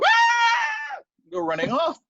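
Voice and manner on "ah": high loud scared scream. Retold or imitated. Humans fleeing the Pooka, high loud scream. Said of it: "the humans go".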